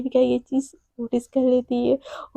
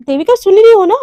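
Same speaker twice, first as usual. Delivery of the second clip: calling out; 4 semitones higher, 9 dB louder than usual. Acted. Radhika calling distracted Devika.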